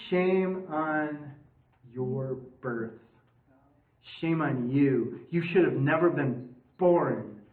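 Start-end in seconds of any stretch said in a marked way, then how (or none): none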